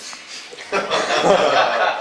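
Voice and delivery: bursting into loud laughter. Real, not acted. Cracking up at his own joke. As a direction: let a big laugh break out.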